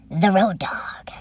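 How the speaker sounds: whispered slowly like a dramatic reveal; hushed movie-trailer whisper; hushed title reveal. Acted. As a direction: breathe the title, slow and hushed.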